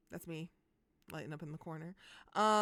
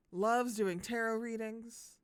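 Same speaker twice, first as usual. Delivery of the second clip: usual speaking voice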